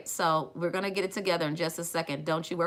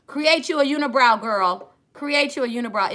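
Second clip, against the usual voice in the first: high, loud playful egging on; excited urging; 7 semitones higher, 8 dB louder than usual. Acted. Vlogger egging on viewers, high and loud excited urging. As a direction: cheer them on to go all the way.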